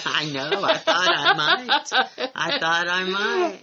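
high-pitched laughing admission. Real, not acted. Group leader joking about nearly being run out of town, laughing. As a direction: talk through real laughter.

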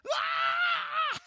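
sudden high yelp of burnt fingers; loud pained cry, laughing through it. Retold or imitated. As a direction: yelp like you just grabbed something red hot. Burned by hot lighter.

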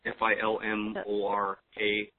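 letters spoken one by one; F I L M O R A, each letter separate; spelling it out. Shown as a demonstration. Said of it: "how do you spell it?".

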